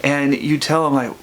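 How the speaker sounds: ordinary delivery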